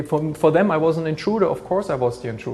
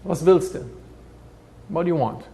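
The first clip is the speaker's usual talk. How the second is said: put-on old survivor speaking Yiddish; 4 semitones higher than usual. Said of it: "said in Yiddish".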